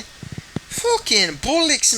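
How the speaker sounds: Irish, very high and slow on "bollocks"; "bollocks" drawn out high in Irish brogue; Conor McGregor accent. Pretend. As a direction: stretch "Fucking bollocks" in a mock Irish whine. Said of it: "I love trying to do a Conor McGregor accent".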